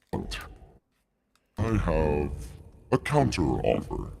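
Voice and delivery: a lightly voiced old dragon, considering. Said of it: "dragon deep voice".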